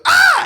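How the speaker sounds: sudden high yelp of fright; a startled driver's sharp scream. Retold or imitated. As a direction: jolt awake with a shriek. Driver jolts awake screaming.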